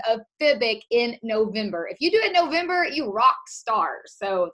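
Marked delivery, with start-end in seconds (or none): 1.9-4.0 excited cheer. Real, not acted. Cheering on qualifying coaches.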